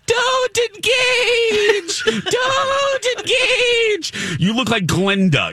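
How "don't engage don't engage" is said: very high, slow, floating angel voice; angelic warning. Pretend. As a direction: sing it out like Glinda from above. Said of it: "I see Lex floating in the air going".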